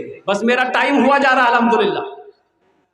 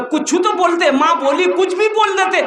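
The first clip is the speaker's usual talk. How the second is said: higher, insistent motherly nagging; exasperated scolding; 6 semitones higher than usual. Acted. Mother tells her shy son to say anything, exasperated. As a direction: nag him in a pitched-up voice.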